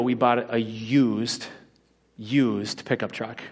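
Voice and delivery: "used" said twice, slowly and drawn out; stress on used. Real, not acted. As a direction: lean hard on "used", stretching it. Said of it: "Let me emphasize the word used".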